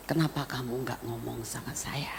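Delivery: very soft, low, near-whispered question; hushed reproach. Retold or imitated. Mother asking her husband why he hid it, hushed. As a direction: ask it quietly, as a hurt wife.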